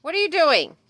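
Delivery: high, loud call across the yard. Real, not acted. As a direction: call out to the animal. Calling out to the animals.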